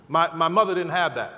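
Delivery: ordinary delivery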